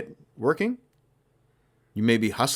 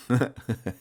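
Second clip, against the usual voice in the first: awkward giggle; 4 dB quieter than usual. Real, not acted. Host giggling after asking listeners an awkward question, awkward giggle. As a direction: giggle briefly at your own question. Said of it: "I'm just giggling".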